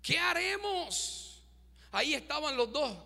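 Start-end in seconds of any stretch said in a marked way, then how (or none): 0.0-1.4 slow, higher, softer cry of worry; fearful servant. Acted. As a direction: plead slowly in fear. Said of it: "su criado le dijo". (siervo asustado, lento y agudo)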